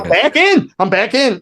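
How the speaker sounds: high, excited burst easing slightly; retold elation. Musician privately cheering that he's back in, high and elated. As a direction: celebrate being saved, pitched well up. Retold relief after saving himself.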